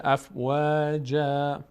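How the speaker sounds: افواجا with a long final a, slow; slow افواجا, alif at the end; pausal form. Shown as a demonstration.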